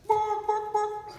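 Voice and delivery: high, loud, squawked chicken bark; squawking chicken. Acted. Chicken in the joke speaks.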